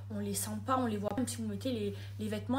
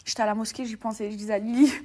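ordinary talk, nothing marked

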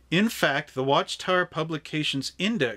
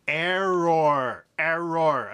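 robot voice; much slower than usual. Pretend. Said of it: "I sound like Star Trek".

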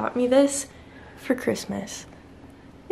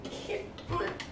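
softer, unvoiced, barely holding it together; breathless whisper; 4 semitones higher, 12 dB quieter than usual. Real, not acted. Girlfriend whispering as she breaks down, hurt.

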